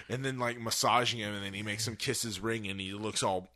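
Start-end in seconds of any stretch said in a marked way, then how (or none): none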